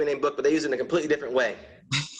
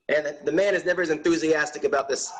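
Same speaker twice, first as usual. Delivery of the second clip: ordinary delivery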